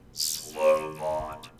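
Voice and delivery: processed machine voice; robot voice. Shown as a demonstration. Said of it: "I think that sounds pretty good".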